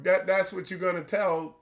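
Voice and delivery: usual speaking voice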